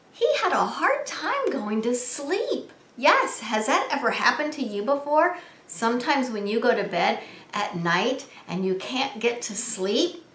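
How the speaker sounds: ordinary delivery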